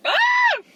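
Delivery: loud, very high squeal of disgust; high-pitched scream. Real, not acted. Vlogger reacting to too-sweet cookie, high scream. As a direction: shriek at a horrible taste.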